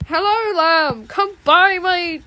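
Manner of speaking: high, slow sales pitch; hammed-up shop character; mock merchant voice. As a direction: lure the buyer in sweetly.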